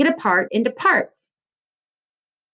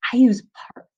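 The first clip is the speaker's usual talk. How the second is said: whispered emphasis; 3 dB louder, faster than usual. Real, not acted. Teacher whispers key point.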